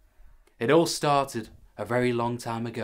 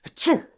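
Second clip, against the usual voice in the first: loud fake sneeze; 9 dB louder than usual. Loud sneeze interrupting the story.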